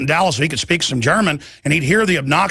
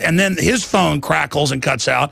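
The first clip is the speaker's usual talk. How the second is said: ordinary delivery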